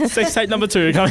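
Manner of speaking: high, loud joke through giggles; laughing mock announcement. Joking to co-hosts about a revamp, laughing announcement. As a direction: shout the gag while cracking up.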